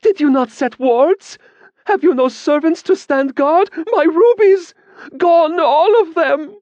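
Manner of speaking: high, loud, slow wailing; a woman wailing at the mage over her lost rubies; rising wail. A narrator's reading. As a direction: wail over the stolen rubies, near tears. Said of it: "Her voice rose into a wail".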